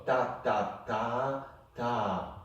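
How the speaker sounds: ta ta ta ta clapped out in rhythm; rhythmic syllables, short short long longer; rhythm demo. Shown as a demonstration. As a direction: sound out the rhythm for the student. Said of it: "Start with the beginning".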